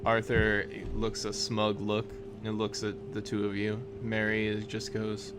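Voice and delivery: ordinary talk, nothing marked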